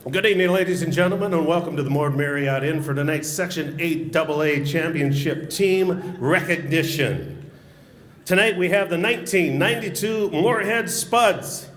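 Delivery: booming arena PA announcer, ramping up; big sports announcer call building to a shout. Acted. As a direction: sell it like starting lineups in a rink. PA announcer intro.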